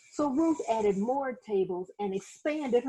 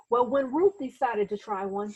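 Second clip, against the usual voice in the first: ordinary delivery, nothing marked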